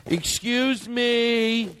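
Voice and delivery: high, slow, overly polite; prissy customer voice. Acted. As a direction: ask sweetly like a fussy diner. Polite diner asking for soup.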